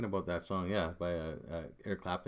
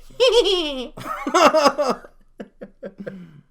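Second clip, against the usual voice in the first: laughing fit; 13 dB louder than usual. Real, not acted.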